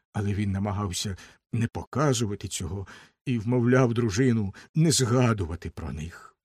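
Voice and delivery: ordinary delivery, nothing marked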